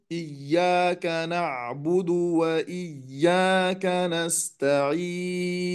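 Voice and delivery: "إياك نعبد وإياك نستعين" drawn out, sakin letters held longer; تلاوة بطيئة مرتلة; slow recitation. Shown as a demonstration. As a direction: recite slowly, giving each letter its time. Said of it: "نقول".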